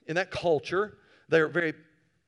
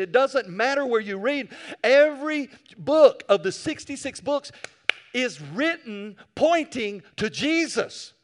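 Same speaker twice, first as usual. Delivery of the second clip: higher, louder preacher's build through the books of the Bible; preaching at full force; 5 semitones higher, 5 dB louder than usual. Acted. Preacher driving home to the church that all scripture points to Jesus, loud. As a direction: build it like a revival climax.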